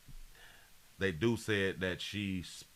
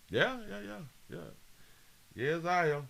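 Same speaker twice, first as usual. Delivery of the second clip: mock high voice; 9 semitones higher than usual. Mocking high voice.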